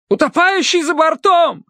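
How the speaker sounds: very high-pitched urgent cry; alarm call. A narrator's reading. Terence alerts the boat to a dog overboard, alarm call. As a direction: call out the emergency.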